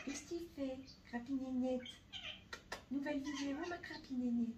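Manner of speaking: high, sing-song voice for the cat; pet talk voice. Real, not acted. Talking to her cat.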